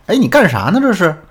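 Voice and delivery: mock-irritated challenge, pitched high. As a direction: snap it as an annoyed husband, acted. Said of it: "没好气儿地冲我说了一句". (模仿丈夫没好气儿地质问)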